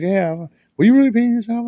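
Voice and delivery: mock nurse asking, pitched high and loud; nurse's voice. Retelling the nurse's question.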